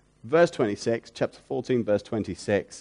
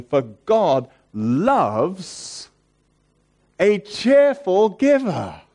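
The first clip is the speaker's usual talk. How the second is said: slow, louder, higher, stretched out; fervent quote; 8 semitones higher, 5 dB louder, much slower than usual. Real, not acted. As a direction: let the verse swell with joy. Reading scripture with rising fervour.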